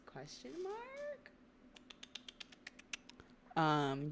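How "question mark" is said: much higher, slow quizzical squeak; confused. Retold or imitated. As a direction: squeak it out like a baffled question. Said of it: "And he goes".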